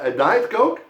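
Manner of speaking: Diet punched, Coke left rising; stress on Diet. Shown as a demonstration. Teacher quizzes the class on contrast, stressing Diet. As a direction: hit Diet, leave the end hanging. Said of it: "not a non-Diet Coke".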